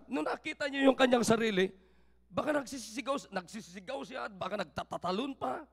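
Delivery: ordinary talk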